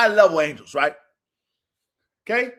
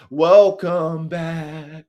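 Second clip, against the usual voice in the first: very slow, stretched-out words; drawn-out welcome; 3 semitones lower, 4 dB louder, much slower than usual. Real, not acted. Greeting new guys to soldier talk, drawn-out welcome. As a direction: draw out each word of the welcome. Draws out repeated welcome playfully.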